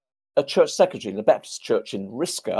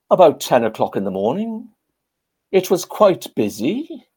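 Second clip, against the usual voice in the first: high Welsh lilt, understated; Trevor Bray; 7 semitones higher, 4 dB louder than usual. Retold or imitated. Veteran plays down Gold Beach, imitated Welsh accent. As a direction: imitate a modest Welshman, slowing at the end. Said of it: "he said in his Welsh accent".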